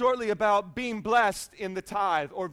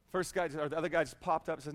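ordinary delivery

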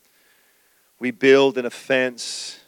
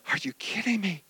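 hushed disbelief; 3 semitones higher, 10 dB quieter than usual. Retold or imitated. Aside to his wife.

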